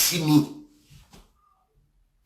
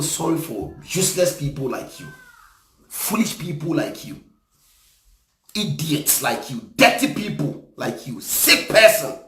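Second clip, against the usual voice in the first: scornful pity; 4 semitones lower, 4 dB quieter than usual. Real, not acted. Scornful insults at clip's followers.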